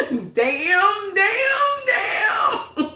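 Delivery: high, drawn-out mock wail, climbing sharply; hammed-up exclamation. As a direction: stretch each word out high for comic effect.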